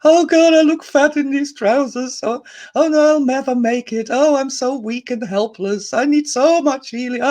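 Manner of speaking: high, whimpering self-pity; whiny helpless guy. Retold or imitated. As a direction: wail it out like someone who feels weak and hopeless. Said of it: "As we go further down, we have somebody who's, you know, just like".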